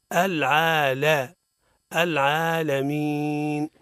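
العالمين slow and half-chanted, the madd held steady; correct recitation. Shown as a demonstration. Teacher modelling العالمين after the mistake. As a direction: chant العالمين slowly without bending the madd. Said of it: "لا".

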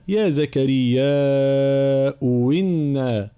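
slow chanted recitation; slow melodic Quranic chant. Shown as a demonstration. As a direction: recite slowly in tajwid style. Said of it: "إذن هنا".

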